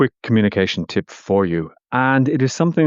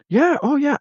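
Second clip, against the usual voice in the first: other person replying. Pretend. Acted reply in imagined small talk.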